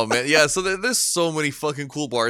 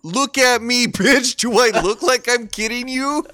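much higher and louder, daring her; mock defiant shout; 10 semitones higher, 6 dB louder than usual. Retold reply to the doubting waitress, loud and high. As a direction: bark it back at the waitress, hammed-up. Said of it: "I'm like".

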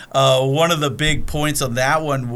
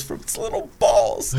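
breathy giggle through the words; giggled punchline. Retold or imitated. Ned answering Steve Martin, giggling through it. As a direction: laugh at your own dad joke. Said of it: "He goes".

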